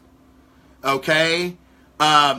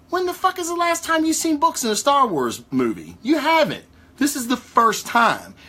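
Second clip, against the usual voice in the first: much higher, disbelieving rant; can you believe this, pitched way up; incredulous outburst; 8 semitones higher than usual. Real, not acted. Incredulous rant about books.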